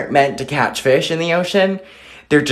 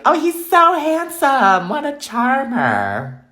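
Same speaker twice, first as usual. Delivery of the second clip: much higher, slow swooning voice; swooning wife; 13 semitones higher, slower than usual. Retold or imitated. An old wife gushing over her husband, high and slow. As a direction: gush over him dreamily. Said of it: "you're still gonna be like".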